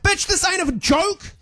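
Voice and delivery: exasperated fan. Retold or imitated. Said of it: "everyone's like".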